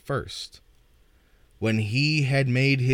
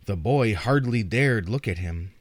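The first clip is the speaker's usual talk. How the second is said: plain reading voice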